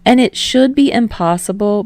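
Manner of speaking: usual speaking voice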